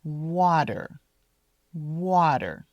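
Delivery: Water said like wader; Water, T flapped to a D. Shown as a demonstration.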